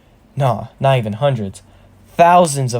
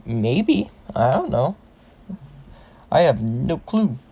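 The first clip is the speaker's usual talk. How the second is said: unsure shrug. Acted. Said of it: "shrugs her arms and is like".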